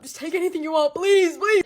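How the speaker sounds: loud, high begging; mock panicked plea. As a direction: beg like you're being robbed.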